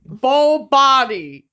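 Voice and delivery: Creed impression. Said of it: "he's like".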